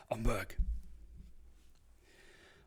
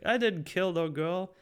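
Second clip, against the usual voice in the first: high, indignant mobster denial; Penguin voice; 10 semitones higher, 4 dB louder than usual. Retold or imitated. Penguin denies the killing to Batman, high and indignant. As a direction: protest your innocence, pitched up. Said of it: "It's like".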